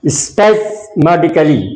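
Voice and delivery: spasmodically sounded out very slowly, syllable by syllable; slow pronunciation demo. Shown as a demonstration. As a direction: drag out spasmodically for the learner, falling at the end. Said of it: "क्या उच्चारण है?". (spasmodically को बहुत धीरे, एक एक अक्षर बोलकर)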